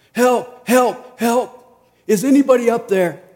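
high, loud desperate cries for help; yelling for help. Acted. Man dangling off cliff yells up for help, loud desperate shout. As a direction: shout up the cliff like your life depends on it. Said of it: "he starts yelling for help".

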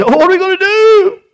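hammed-up cry of despair; panicked wail. Bitten victim cries out to family, panicked wail.

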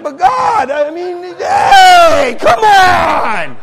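high, wailing shout of protest; loud protest. Retold or imitated. Traveler arguing back to God, wailing shout. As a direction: plead at full volume, stretching the last words.

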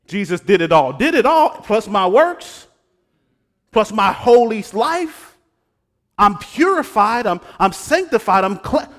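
mock boasting. Mocking a legalist bragging about works, loud and pompous. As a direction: puff yourself up like a legalist. Parodying self-righteous legalist claim.

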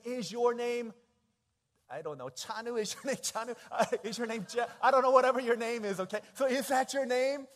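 a hint of a girl asking his name; eager girl. Girl at the cafeteria table asking his name, lightly voiced and eager. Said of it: "She said".

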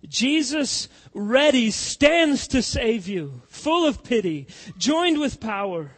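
loud, rising pulpit preaching; fiery preaching. Acted. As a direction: preach it hard to the congregation. Preaching the hymn words.

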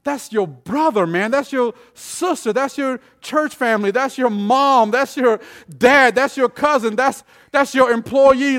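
emphatic, rising sermon list; insistent preaching. Real, not acted. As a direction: hammer each relation home, building.

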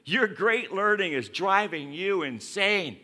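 loud, high outburst at Paul; shouted accusation. Acted. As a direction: bellow it like an angry governor. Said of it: "he shouted".

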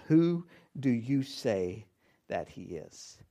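slow and searching, each word weighed; slow, weighty question. Shown as a demonstration. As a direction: let the question hang, slowly. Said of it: "You need to wrestle with that".

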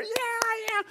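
high-pitched whooping yeah; cheering crowd. Acted. Crowd cheering on the swinging gorilla, high excited whoops. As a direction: cheer it high like a thrilled fan.

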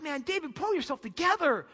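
hammed-up nagging at the husband; wife scolding David in the street, high and sharp. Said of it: "She's like".

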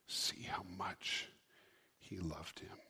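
whispered, slow aside; hushed murmur. Acted. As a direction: breathe it out in awe. Onlookers murmur at Jesus weeping.

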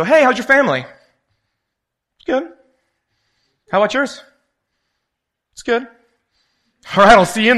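high, bright mock greeting chatter; chirpy small talk. Churchgoers trading empty pleasantries in a high chirpy voice. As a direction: play both sides of a breezy hello.

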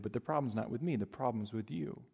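normal talking voice

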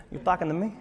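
hint of a mock Italian tough guy; soft, incredulous Italian-American questioning; lightly put-on Italian accent. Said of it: "I don't know why he's Italian".

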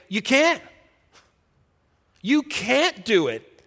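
a loud, high, slow preaching answer; preached reply. Acted. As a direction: answer the despair with force. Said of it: "And I say".

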